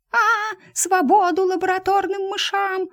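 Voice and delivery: high shrieking protest cry; squealing mouse. Acted. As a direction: shriek it like a tiny rebel. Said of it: "немедленно заверещала оттуда".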